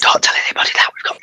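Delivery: rapid conspiratorial whisper. Pretend. Host swearing the others to mock secrecy, whispering fast. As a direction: whisper it quickly, as if confiding. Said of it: "Are we keeping it secret?".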